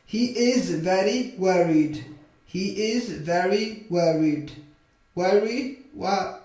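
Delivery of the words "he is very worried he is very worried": slow, stretched-out model sentence; slow modelling. Shown as a demonstration. Teacher models a sentence for English learners, slowly. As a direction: draw the words out for learners.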